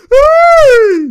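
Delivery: high shrieking laugh; loud high laugh. Real, not acted. Reacting to a good handoff, shrieking laugh. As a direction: burst into a big laugh. Watching highlight, big reaction.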